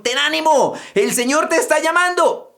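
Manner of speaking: high excited call; calling out encouragement. Retold or imitated. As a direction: call him over, eager. Crowd calls the blind man.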